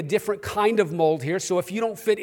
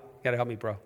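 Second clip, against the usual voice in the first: low, softer New York aside; New Yorker accent; 8 semitones lower, 6 dB quieter, much faster than usual. Pretend. Preacher pleading over a Facebook friend, New Yorker accent. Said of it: "It's my New Yorker coming out".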